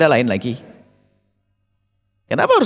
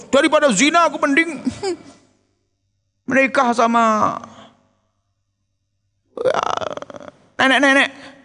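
high mocking voice with a laugh; preacher mocks a man's silly oath, high and laughing; mocking quote; 9 semitones higher, slower than usual. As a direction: sneer at the foolish vow in a pitched-up voice. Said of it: "ngapain kamu ngomong gitu?".

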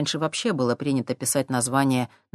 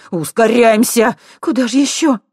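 urgent; 7 semitones higher, 8 dB louder than usual. Acted. Fleeing from dogs.

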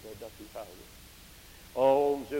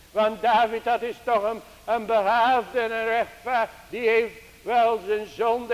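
raised, ringing pulpit voice, higher and louder; fervent preaching; 7 semitones higher, 9 dB louder than usual. Acted. Preacher urging the congregation to cry for grace, in a raised fervent voice. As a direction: preach it out to the congregation with rising urgency.

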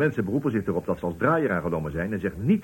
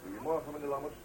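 soft, higher, deferential hello; timid greeting; 6 semitones higher, 10 dB quieter than usual. Acted. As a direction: greet the boss meekly. Employee greets boss timidly.

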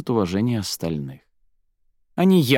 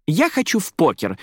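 high, eager call; eager exclamation; 8 semitones higher than usual. A narrator's reading. Zaira picks the game before anyone else, exclaiming eagerly. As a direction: jump in with your choice at once. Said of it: "сразу воскликнула Заира".

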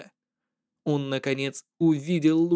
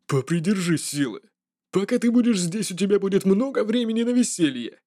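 menacing jester voice; 3 semitones higher than usual. Acted. Jester threatens playfully.

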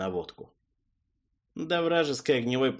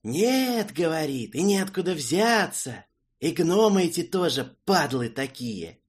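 angry rant; 7 semitones higher, 4 dB louder than usual. Acted. Character keeps raging at betrayal.

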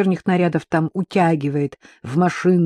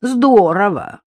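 slow, higher, mocking repeat; sarcastic echo; 4 semitones higher, 5 dB louder, slower than usual. A narrator's reading. Pavlik throwing her wish back at her, sarcastically. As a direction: stretch the word out with biting sarcasm. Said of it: "язвительно повторил Павлик".

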